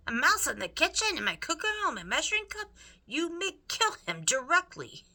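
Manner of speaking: loud, high, fast outraged rant; outraged cook. A narrator's reading. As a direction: spit it out in fury.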